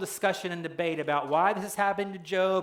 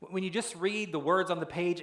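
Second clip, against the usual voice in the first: ordinary talk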